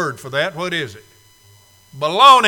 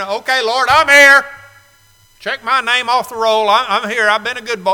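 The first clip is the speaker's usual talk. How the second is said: mock churchgoer; 4 semitones higher, 7 dB louder than usual. Mocking churchgoer checking in.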